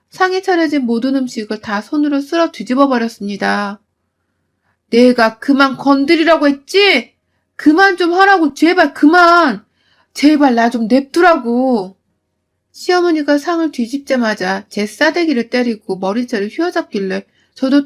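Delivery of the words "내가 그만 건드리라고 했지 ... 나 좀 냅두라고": exploding shout. Acted. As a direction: scream at them to stop, at the end of her rope. 며느리가 폭발해 소리침. (한계에 달해 크고 느리게 외치는 며느리)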